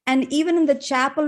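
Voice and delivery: ordinary talk, nothing marked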